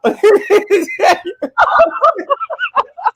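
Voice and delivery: long shrill cackle of laughter; high laughing burst. Real, not acted. Laughing at fighter clip.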